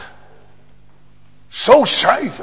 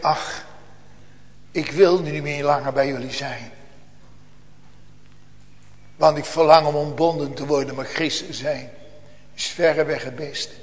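low, soft, subdued voice of a mother on her deathbed; dying mother; 5 semitones lower, 8 dB quieter than usual. Acted. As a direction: gently let go of the family, softly. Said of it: "En moeder zegt". (zachte, gedempte stem van stervende moeder)

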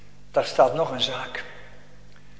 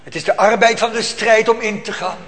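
preaching at full force; 6 semitones higher, 7 dB louder than usual. Acted. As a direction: thunder out the struggle of faith. Preacher builds to a climax. (hoog en luid preken, vol vuur)

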